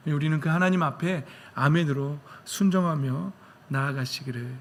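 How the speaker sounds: ordinary delivery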